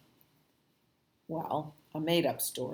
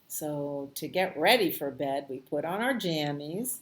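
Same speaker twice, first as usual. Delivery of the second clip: ordinary delivery, nothing marked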